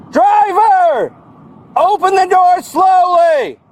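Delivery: loud commanding shout; shouted police commands; shouting orders. Real, not acted. Deputy orders driver out.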